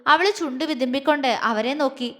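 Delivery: plain reading voice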